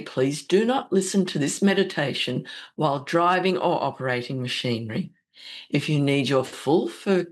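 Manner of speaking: normal talking voice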